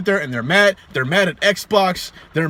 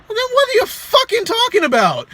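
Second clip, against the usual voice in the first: exasperated rant. Real, not acted. Ranting at console fanboys.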